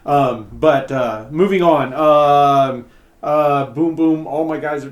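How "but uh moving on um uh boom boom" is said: usual speaking voice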